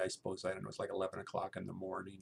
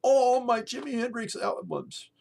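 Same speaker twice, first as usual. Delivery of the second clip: much higher, loud mock outrage; frantic yelling roommate; 11 dB louder than usual. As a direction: yell like a roommate who can't find his record. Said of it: "And he goes".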